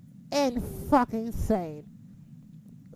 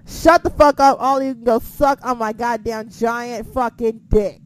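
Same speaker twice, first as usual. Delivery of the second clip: retold angry yell; 12 dB louder than usual. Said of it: "I was just like".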